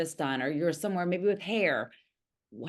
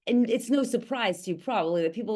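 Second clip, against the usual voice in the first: ordinary delivery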